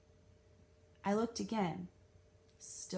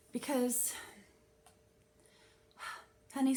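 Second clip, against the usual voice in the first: sighing librarian; 4 semitones higher than usual. A narrator's reading. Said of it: "sighed and wrung her hands".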